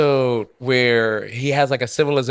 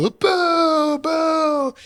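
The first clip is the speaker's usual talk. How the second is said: booing crowd; 14 semitones higher than usual. Retold or imitated. Crowd booing Rick in story.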